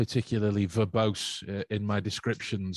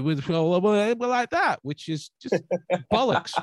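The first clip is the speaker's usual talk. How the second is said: high, loud exasperated outburst; incredulous rant; 9 semitones higher, 5 dB louder than usual. Real, not acted. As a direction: scoff at how men behave. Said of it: "mocking men's reserve".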